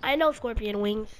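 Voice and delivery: ordinary delivery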